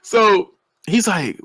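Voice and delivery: usual speaking voice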